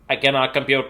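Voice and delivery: monotone computer voice shutting down; malfunctioning robot brain. Acted. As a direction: talk like a computer powering down. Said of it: "brain just shuts down ... it'll be like".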